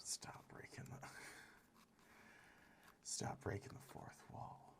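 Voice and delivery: soft voiceless whisper. Real, not acted. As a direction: whisper it as an aside. Whispered aside.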